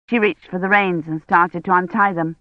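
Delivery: usual speaking voice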